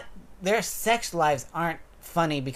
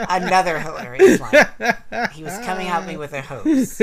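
laughing; 4 semitones higher, 6 dB louder than usual. Real, not acted. Laughing while quoting funny line.

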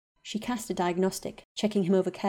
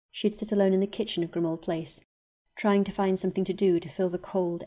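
plain reading voice

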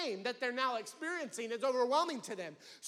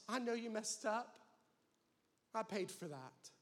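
much softer, lower and gentle; tender voice of the Spirit; 4 semitones lower, 7 dB quieter than usual. Acted. The Spirit gently calling the sinner back home. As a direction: say it like a loving whisper. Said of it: "the voice of the Spirit calls to us".

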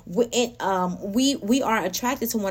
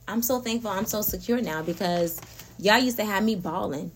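normal talking voice

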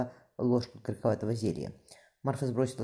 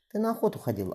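high, astonished exclamation; gasping surprise; 10 semitones higher than usual. Acted. Young mother astonished at the gold, gasping. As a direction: she can't believe what she sees. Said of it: "ахнула она".

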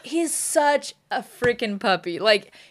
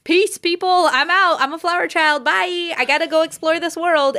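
put-on higher, louder carefree flower-child voice; dog's hippie voice; 8 semitones higher, 5 dB louder than usual. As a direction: voice the dog as a breezy flower child. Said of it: "she was like".

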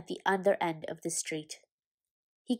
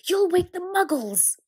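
high, loud, urgent telling-off; urgent scolding; 11 semitones higher, 7 dB louder than usual. Acted. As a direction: scold him sharply before the neighbours wake. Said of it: "hissed Professor McGonagall".

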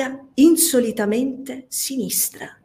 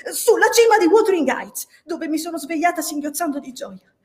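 passionate; 7 semitones higher than usual. A narrator's reading. Catherine passionately tells her dream.

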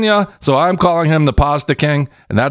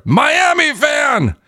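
triumphant shout; 12 semitones higher, much slower than usual. Real, not acted. Proclaiming new fandom.

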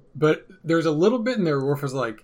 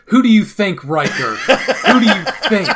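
loud, high-pitched mock exasperation; sarcastic outburst; 5 semitones higher, 10 dB louder, slower than usual. Joker mocking Riker for asking who was on duty, loud sarcastic exasperation. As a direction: shout it as a rhetorical jab, stretching the repeat.

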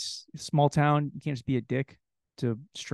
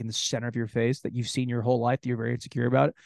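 ordinary delivery

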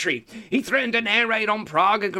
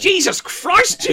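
shocked; 12 semitones higher, 6 dB louder, slower than usual. Real, not acted. Shocked at the coke request.

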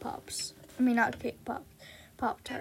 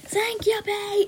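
high, loud sing-out thanks; calling out; 9 semitones higher, 4 dB louder, slower than usual. Acted. [name] thanking the drive-thru lady, high and loud. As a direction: toss it out high to the server.

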